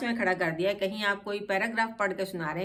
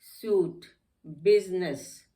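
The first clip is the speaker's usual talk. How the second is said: ordinary talk, nothing marked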